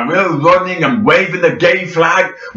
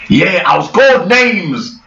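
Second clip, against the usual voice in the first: usual speaking voice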